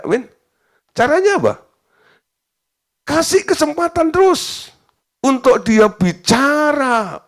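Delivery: high-pitched, insistent sermon delivery; forceful preaching. Real, not acted. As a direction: preach it hard, voice pushed up high. (khotbah berapi-api dengan nada tinggi)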